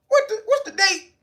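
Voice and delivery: mock alarm. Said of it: "You be like".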